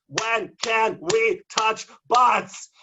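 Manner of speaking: slow, high and loud; slow loud demand. Retold or imitated. Poet pressing the official again, slow loud demand. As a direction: drag the question out.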